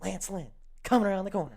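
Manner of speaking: fast, high mock race call; NASCAR race commentator. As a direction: call it quickly like live racing commentary. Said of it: "not so much nascar".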